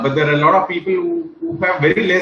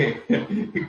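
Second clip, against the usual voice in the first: laugh; 3 semitones higher, 7 dB quieter than usual. Real, not acted. Still laughing at the joke.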